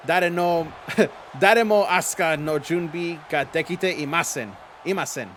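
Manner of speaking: slow, loud, earnest Japanese recital; self-made Japanese speech. Pretend. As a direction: recite it proudly in shaky Japanese. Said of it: "How was that?".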